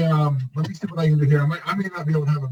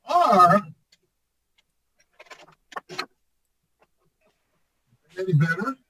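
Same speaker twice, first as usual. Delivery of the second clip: echoing tunnel; 4 semitones higher than usual. Real, not acted. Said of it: "You sound like you're in a tunnel".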